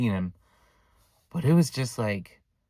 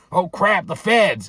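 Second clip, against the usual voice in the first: loud, raised panic imitation; mock alarm; 7 semitones higher, 7 dB louder, slower than usual. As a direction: act out an uncle spooked by police. Uncle panics at gun threat.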